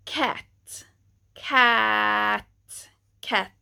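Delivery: "cat" three times, short a; English example. Shown as a demonstration. Teacher comparing ä to English cat. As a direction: model the a of "cat". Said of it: "like the a in".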